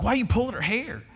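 high-pitched, incredulous question; retold bewildered protest. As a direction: demand an explanation, voice jumping up. Father questioning nurse in retelling.

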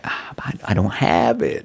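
slow, drawn-out fake regret; feigned reluctance. Driver letting saleslady squirm over extra product, mock-regretful. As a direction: drag it out as if reluctantly breaking bad news.

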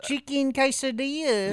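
high, "quesadilla" said "kay-sa-dee-a"; mock overpronunciation. Cohost echoing the order, high fake Spanish. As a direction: echo it too Spanish. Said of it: "trying to pronounce".

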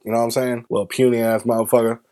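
ordinary talk

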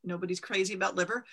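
usual speaking voice

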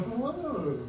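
soft, swooping mock-queen voice; teasing queen. Queen sitting beside the king of Persia, softly goading him. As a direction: murmur it softly, putting pressure on the king. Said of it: "queen kinda going".

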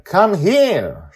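put-on high, loud child voice; pointing girl. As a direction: call her out while pointing. Joy miming instructions.

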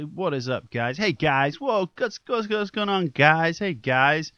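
hammed-up YouTuber hype, rising to a mock whoa; mocking influencer. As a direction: parody the internet personalities' greeting. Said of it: "they all say".

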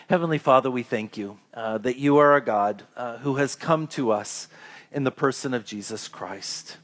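soft, low, reverent prayer voice. Acted. Pastor leading the congregation in closing prayer, soft and reverent.

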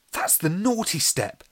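put-on high, slow and indignant child's voice; Alex's accusing voice. A narrator's reading. Narrator voices Alex the child.

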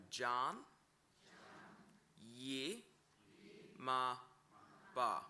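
Jan, Yi, Ma, Ba spoken one syllable at a time with pauses. Shown as a demonstration. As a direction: break Jan, Yi, Ma, Ba into separate syllables. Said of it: "Jan Yimaba".

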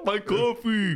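mock wail. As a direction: cry out over lost coffee, hammed-up. Jokes Quasimodo's coffee started it.

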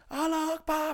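high-pitched mock battle cry; finto grido di guerra. Mock battle cry before exploding.

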